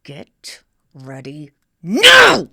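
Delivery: drawn out, loud parent shout; fed-up parent ordering kids to dress; slow shouted command. Retold or imitated. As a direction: stretch each word as you shout. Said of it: "tried them changing seven times".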